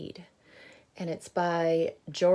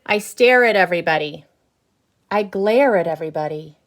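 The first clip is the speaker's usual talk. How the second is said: grumpy bad seed; 2 semitones higher, 11 dB louder than usual. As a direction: boast about being bad, loud. Reading the seed's lines aloud.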